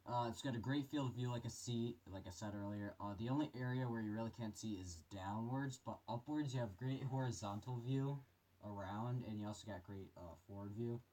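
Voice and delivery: muffled voice inside a respirator; through a gas mask. Real, not acted. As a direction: talk from behind a gas mask. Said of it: "put this mask on here for you guys".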